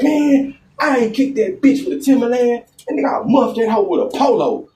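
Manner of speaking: friend's voice pitched high; quoting his friend. Retold or imitated.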